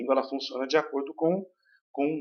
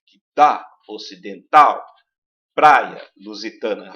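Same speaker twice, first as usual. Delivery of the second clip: slow and louder, "dá" and "praia" hit hard; open A stressed; 8 dB louder, slower than usual. Shown as a demonstration. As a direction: dwell on every open A in "praia". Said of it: "Aparece o A com muita força".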